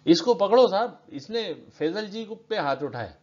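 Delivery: high, loud cry for help to the police; urgent accusing call. A narrator's reading. Bystander telling the officer to grab the attacker, urgent high call. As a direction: call out urgently, pitch jumping up.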